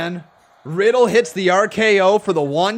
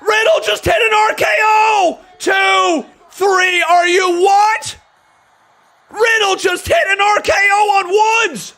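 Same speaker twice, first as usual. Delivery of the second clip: much higher and louder, yelling the finish; stunned shouting; 12 semitones higher, 5 dB louder than usual. Real, not acted. Commentator yelling as Riddle wins with a surprise RKO. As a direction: scream it as the RKO comes out of nowhere.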